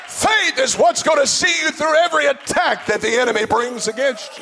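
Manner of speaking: loud, high, fervent preaching. Real, not acted. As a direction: build up to a shouting sermon. Preacher builds to full force.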